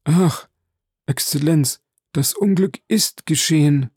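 high-pitched, wailing lament; despairing. A narrator's reading. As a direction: cry it out as if all is lost. Steward despairs over the misfortune.

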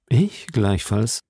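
slow, composed musketeer; Aramis. Acted. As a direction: agree slowly, in an unruffled voice. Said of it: "versetzte Aramis". (langsame, gefasste Musketierstimme)

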